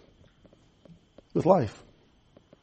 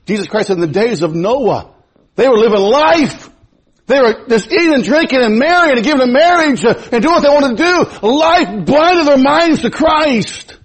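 full-force preaching; 10 semitones higher, 5 dB louder than usual. Acted.

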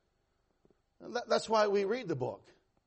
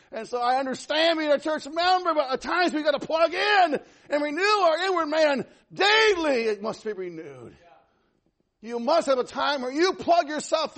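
high, shouted preaching and prayer; impassioned preaching; 10 semitones higher, 8 dB louder than usual. Real, not acted. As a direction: cry out at full force. Preaches and prays at full force.

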